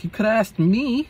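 lifted high, kindly; a sweet lady's offer; high caring lady voice. Retold or imitated.

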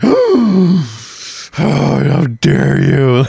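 high, very slow hammed-up indignation; stretched-out high mock gasp of offense; mock outrage. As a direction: overplay the prissy offense. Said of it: "you're like".

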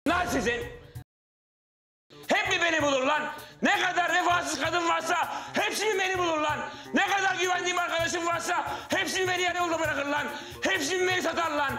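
high-pitched, anguished shouted rant; full-blown escalation. Acted. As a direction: wail in outrage like a man betrayed by everyone. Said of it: "yüksel Murat".

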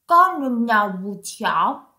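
low storybook frog voice; croaking frog. Acted. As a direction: croak out a greeting as a frog, deep in pitch. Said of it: "του είπε ο βάτραχος". (χαμηλή φωνή βατράχου που κοάζει)

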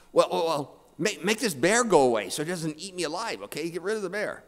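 high-pitched, stammering plea; panicked pleading. Acted. Atheist begging God to remove the bear, panicked.

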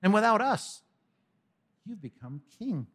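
high start, falling to a hush; mock reverence; sarcastic. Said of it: "All that sarcasm".

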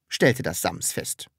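ordinary delivery

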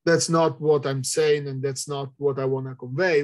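ordinary talk, nothing marked

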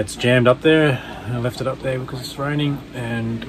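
usual speaking voice

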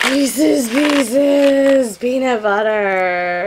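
drawn-out delighted cheer; excited exclamation. Real, not acted. Finding favorite candy in a package, drawn-out excitement. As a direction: stretch every word in excitement.

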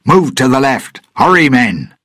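high, urgent shout to the crew; shouting orders. A narrator's reading. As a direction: yell commands to hurry your men. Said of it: "and shouted".

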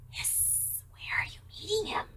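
breathy whispered snake question; whispering snake. Acted. Snake asks the mouse where they meet.

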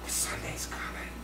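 soft whispered "Sunday's coming"; whispered refrain. Retold or imitated. Preacher whispering the Sunday refrain to the congregation. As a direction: let the hope drop to a whisper.

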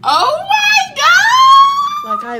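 high, loud squealing cry of joy; joyful shriek. Real, not acted. Overwhelmed vlogger shrieking with joy to her viewers. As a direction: shriek it out, overjoyed.

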